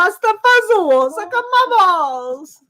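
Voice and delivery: loud, high mock Italian accent; sing-song fake Italian, stretched out; bad Italian accent. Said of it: "a bad Italian accent".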